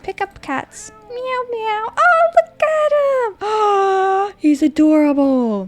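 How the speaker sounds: very high cooing meows; squeaky pet voice. Real, not acted. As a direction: coo at the kitty way up high. Squealing at cat.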